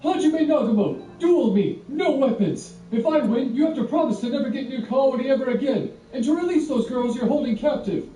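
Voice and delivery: high, loud, indignant proclamation; loud declared challenge. A narrator's reading. Kouki challenging Hajime to a duel, loud angry declaration. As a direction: throw down the challenge for all to hear. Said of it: "declared his intent for all to hear".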